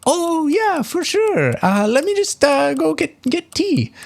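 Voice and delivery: very high falsetto, eager and cheerful; bubbly hostess. Acted. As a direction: chirp like a fussing hostess. GM voices Rose offering tea.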